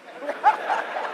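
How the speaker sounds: breathy disbelieving laugh; incredulous laugh. Real, not acted. Laughing in disbelief at the nickname, breathy laugh.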